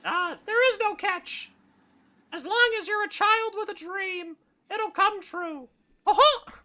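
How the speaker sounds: very high squeaky cartoon mouse; chirpy theme-park mascot; Mickey Mouse voice. Mouse explaining.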